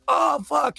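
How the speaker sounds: high-pitched frightened yelp, imitated; panicked mock cry. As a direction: imitate a terrified bystander, voice shooting up. Retold girlfriend held at gunpoint.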